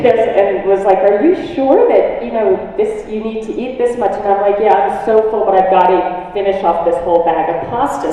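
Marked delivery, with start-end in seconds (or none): 1.0-4.1 a loud hint of a doubting mom; doubtful mom. Mom doubting the pasta pile. 4.6-8.1 louder, pushing through fullness; loud, stubborn carbo loader; stuffed, insistent. Retold or imitated. Stuffed but insisting on eating.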